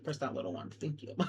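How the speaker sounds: very soft, understated request. Real, not acted. Vlogger asking viewers to press a button, very quietly. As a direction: drop to a hushed thank-you. Low-key sign-off aside.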